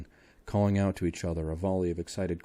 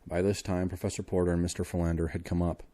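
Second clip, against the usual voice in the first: plain reading voice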